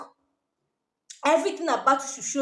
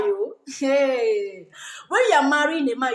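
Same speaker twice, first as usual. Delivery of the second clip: ordinary talk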